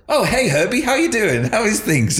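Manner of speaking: high-pitched hello to an acquaintance; imitated greeting. Stevie recognizes the voice and greets Herbie, high-pitched. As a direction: answer in a lifted, high voice. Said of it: "recognized the voice and goes".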